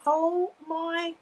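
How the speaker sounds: high, drawn-out amazed exclamation; real astonishment, voice lifting; overwhelmed, emotional. Real, not acted. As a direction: stretch it out loud and high, truly overcome. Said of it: "I'm getting emotional now".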